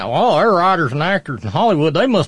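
high, loud mock-impressed voice; mock naive assumption. Voicing what people assume about Hollywood writers, mock-naive. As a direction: parody the easy assumption, drawing out the ending.

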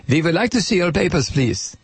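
brisk mock German official; movie German accent. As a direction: demand it quickly in film German. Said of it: "would consider a German accent".

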